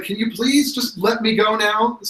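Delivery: high-pitched, impatient request; exasperated plea. Acted. Captive asks the players to let him go, exasperated plea. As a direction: plead to be released, pitched well up. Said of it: "he's just like".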